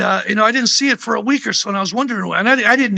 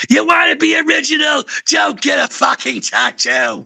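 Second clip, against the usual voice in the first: mock [name] shout; 9 semitones higher, slower than usual. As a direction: bellow the advice like [name] would. Quoting [name]'s blunt advice.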